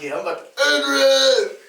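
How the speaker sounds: high, loud mock battle cry; hyped-up fighter. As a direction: play it pumped for a fight. Acts himself hyped up for the fight.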